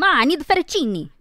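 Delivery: high, loud Italian-family call to Ma; Italian family accent. Shown as a demonstration. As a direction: call out to mom with Italian-family punch on "fettuccine". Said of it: "if I'm talking to my mom".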